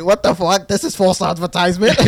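acting out his own outrage, half laughing; outraged. Telling a friend how he reacted to the Tinder girl, mock outrage.

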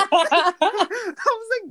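high laugh breaking through slowly; can't stop laughing; real giggles through the words. Real, not acted. Laughing at own story.

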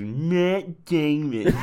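high, very slow, dumb puppet voice; high, dragged-out Matt Damon puppet; Team America puppet. Retold or imitated. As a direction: drawl it like a brainless puppet. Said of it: "the only thing it says is".

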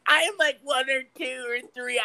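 put-on very high, laughing child's voice; mock the toddler caller, giggling; little kid voice. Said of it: "the little kid's voice".

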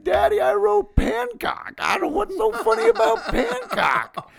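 put-on very high, loud child voice with laughter in it; hammed-up little girl squealing at Dad; imitated tearful daughter. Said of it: "She's like".

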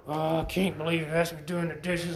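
low whiny complaint; mock grumbling. Preacher contrasts a husband grumbling over dishes, mock whine. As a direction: sulk about doing the dishes. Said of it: "This would be grumbling".

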